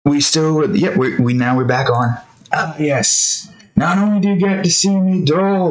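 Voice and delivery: usual speaking voice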